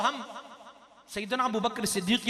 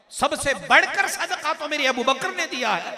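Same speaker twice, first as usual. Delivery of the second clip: raised, louder proclamation; emphatic preaching; 4 semitones higher, 8 dB louder than usual. Acted. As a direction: proclaim it proudly to the congregation. (पुरज़ोर ख़िताबत)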